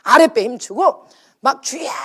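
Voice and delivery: belly-pushed loud call to the Lord; forceful cry demo. Shown as a demonstration. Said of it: "자, 저 따라서 해보세요".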